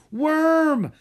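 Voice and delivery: loud, high call of a name across the shore. A narrator's reading. Drake calls out to Worm on the beach, loud high shout. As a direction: shout for him to come back. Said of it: "Drake called out".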